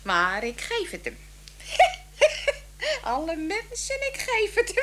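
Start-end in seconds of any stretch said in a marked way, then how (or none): 1.2-3.0 a high, loud burst of cackling laughter. Acted. Wife cackles at her own joke about her husband, high and loud.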